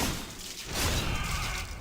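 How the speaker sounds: soft, voiceless hissing laugh; wheezy laugh. Real, not acted. Streamer amused by own community, soft wheezing laugh. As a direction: laugh quietly on escaping air.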